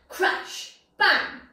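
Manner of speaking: sudden loud bang; loud crash bang. A narrator's reading. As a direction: burst out with the noise. Sound effects in story.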